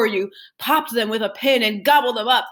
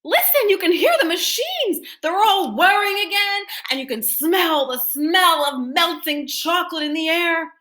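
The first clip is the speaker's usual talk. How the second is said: high excited crowd voice; much higher eager townspeople; shouted excitedly; 9 semitones higher than usual. A narrator's reading. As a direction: urge everyone to listen. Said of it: "the people shouted".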